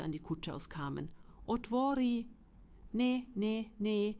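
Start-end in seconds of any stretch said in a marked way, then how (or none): 1.5-2.3 high, loud call at the door; storybook wolf's raised demand; wolf demanding entry. A narrator's reading. Wolf calls at third door. 2.9-4.2 high, raised, repeated refusal; storybook pigs' high chorus of no; piglets calling out. A narrator's reading. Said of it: "riefen die Tri Praseta".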